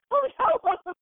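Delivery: high oh no; mock dismay. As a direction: squeak of alarm.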